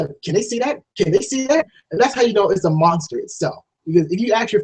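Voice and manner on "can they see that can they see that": worried whisper-like question. Pretend. Voicing a worried viewer.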